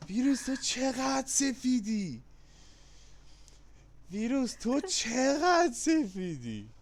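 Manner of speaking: high, slow, singsong taunt; playful jab at a friend who won; mock teasing. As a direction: drag the tease out like a playground chant. Repeats tease after friend's reply.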